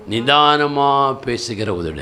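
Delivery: very slow, drawn-out words; measured, unhurried. Shown as a demonstration. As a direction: stretch it out to show careful speech. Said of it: "எப்படி பேசும்?".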